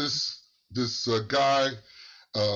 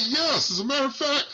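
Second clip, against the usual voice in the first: higher, fast, bright-awake answer; alert and eager; 12 semitones higher, faster than usual. Retold or imitated. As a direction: snap out of the fog and answer fast. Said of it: "He started speaking so alert".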